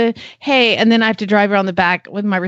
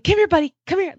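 higher, sing-song coaxing; coaxing the dog; 8 semitones higher, faster than usual. Retold or imitated. Owner coaxes her dog to the door, higher coaxing voice. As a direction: sweet-talk a dog toward you. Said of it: "so then I'm trying, like".

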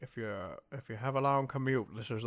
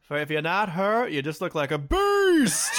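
laughing mock shout; 5 semitones higher, 10 dB louder than usual. Ranting at drag contestants.

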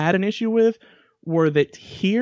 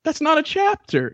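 incredulous protest; 11 semitones higher than usual. Retold or imitated. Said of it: "And I'm just like".

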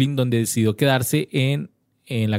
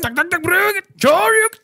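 high shouted special moves; fighting game shout; 4 dB louder than usual. Retold or imitated.

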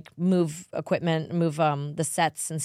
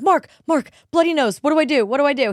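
higher, louder mock panic; fake panic; 10 semitones higher, 8 dB louder than usual. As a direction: cry out as if the kid is bleeding. Faking panic over nosebleed.

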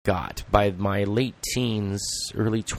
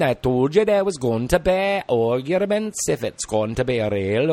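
cheeky Welsh boast; Welsh accent. Shown as a demonstration. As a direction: brag it in Welsh. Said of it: "Welsh dialect".

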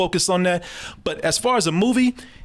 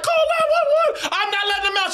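very high, hammed-up panicked voice; mock alarm; faster than usual. Householder shielding the boy, mock panicked high voice. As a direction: imitate a householder raising the alarm. Voicing frightened householder refusing.

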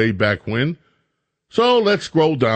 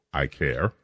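sarcastic mutter; 12 semitones lower, 9 dB quieter than usual. Real, not acted. Sarcastic dismissal of a headline.

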